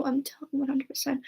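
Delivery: whispered warning. Retold or imitated. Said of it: "I remember, like".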